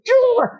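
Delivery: high playful frustrated growl; mock growl. Friend frustrated at the spoiler, playful high growl. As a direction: growl in cartoon annoyance.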